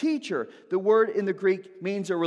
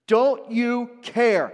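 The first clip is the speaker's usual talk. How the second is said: loud, slow, higher "Don't you care?"; harsh accusation; 6 semitones higher, 8 dB louder, much slower than usual. Retold or imitated. Disciples shouting at sleeping Jesus in the storm. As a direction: hurl it at him, each word hard. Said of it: "they're direct and they're accusatory".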